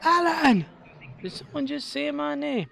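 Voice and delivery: high, loud cartoon meerkat call and reply; quoting the funny part of the meerkat sketch; meerkat bit. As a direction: yell the name then answer in a squeaky meerkat voice. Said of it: "when he goes".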